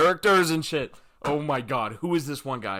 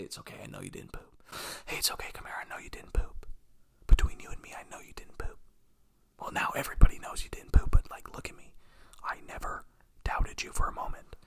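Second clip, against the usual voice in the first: whispered comfort; faster than usual. Pretend. Grown self comforting his little boy self, in a whisper. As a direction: whisper it like a secret to a scared kid.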